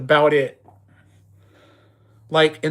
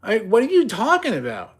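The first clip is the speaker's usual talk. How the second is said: much higher, baffled question; incredulous; 9 semitones higher than usual. Retold or imitated. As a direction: ask it like you can't believe it. Baffled reply to poser.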